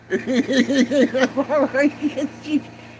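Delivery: high cackling laugh. Real, not acted. Hearing the mom brags to her daughter, high cackle. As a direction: burst into a cackle.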